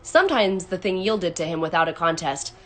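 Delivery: usual speaking voice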